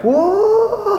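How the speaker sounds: hammed-up surprise; mock whoa. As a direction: act dazzled by the revelation. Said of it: "I was like".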